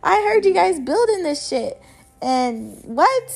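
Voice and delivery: high, loud incredulous protest; mock outraged child; disbelieving kid. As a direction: call out the lie with shocked disbelief. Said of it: "And I'm like".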